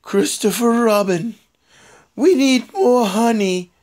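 a touch of cartoon bear; lightly voiced, slow storybook bear; Winnie the Pooh. Bear asking his friend for honey, lightly put-on cartoon voice. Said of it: "I can't do a Winnie the Pooh".

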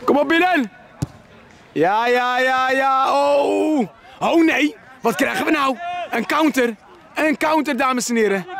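put-on excited sportscaster calling a kids' match, pitch up; live play-by-play. Parent mock-commentating son's football match, excited play-by-play. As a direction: commentate the game like a TV broadcaster.

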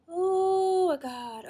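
high, loud, slow stretched gasp of shock; drawn out in real disbelief; shocked oh my God. Real, not acted. Shocked by pore strip pain.

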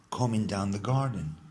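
usual speaking voice

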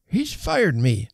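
put-on high, drawn-out complaint of an old man; aggrieved old man's croak. A narrator's reading. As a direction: croak it out slowly, high-pitched. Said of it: "he croaked".

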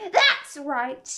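loud, forceful acknowledgement; shouted approval. A narrator's reading. Queen shouts back at her soldiers, approving. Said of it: "shouted the Queen".

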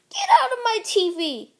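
fast loud yell. Real, not acted. Kid yelling at a game character, fast shout. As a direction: shout him off the screen.

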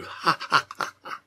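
staged "ha, ha" laughter; acted laugh. A narrator's reading. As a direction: laugh out each ha as the character. Character laughs in acted dialogue.

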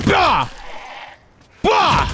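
high, punchy "bah" hit noise; impact cry. Real, not acted. Gamer landing critical hits, high punchy cries. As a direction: burst out high with each blow.

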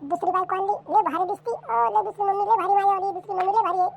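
put-on high, excited little kid bragging; child voice. Kid boasting about the new second mom, high-pitched. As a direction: chirp it like a thrilled small child. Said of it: "म्हणे".

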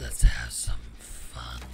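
deep, eerie demon whisper; low, slow whispered demon voice; demonic voice. Acted. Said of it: "a really d-, deep, eerie voice, that's me".

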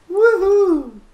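very loud high whoop; excited cheer. Real, not acted. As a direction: shout a big whoohoo. Cheer arriving home.

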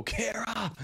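high-pitched name call; delighted shout-out; excited greeting. Real, not acted. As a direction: hail a newcomer with a sudden high cry. Calling out greeting to arriving viewer.

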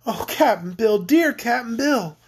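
acted tearful lament, rising high; slow, drawn-out wail of a girl; hammed-up sob, climbing in pitch; sobbing. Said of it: "she sobbed".

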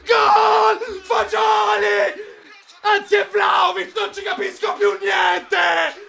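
screaming goal, high and loud; goal shout. Real, not acted. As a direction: explode with joy at the goal. Goal celebration.

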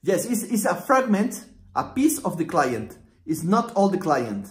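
normal talking voice

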